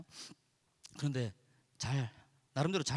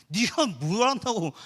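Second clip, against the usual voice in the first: louder, slow snapping retort; mock angry retort; 5 semitones higher, 8 dB louder, slower than usual. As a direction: bite back at a stranger. Retorting to outsiders.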